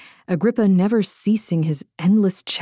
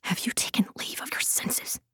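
urgent whisper under breath; hissed whisper; 2 semitones higher, 11 dB quieter than usual. A narrator's reading. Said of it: "Lydia hissed under her breath".

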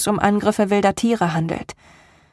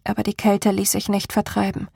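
ordinary delivery, nothing marked

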